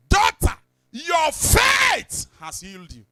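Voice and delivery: high, loud and slow proclamation; Jesus's words shouted to the congregation; slow preaching shout. Acted. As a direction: boom out the healing words slowly. Quoting Jesus in sermon.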